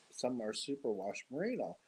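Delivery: normal talking voice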